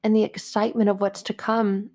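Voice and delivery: ordinary talk, nothing marked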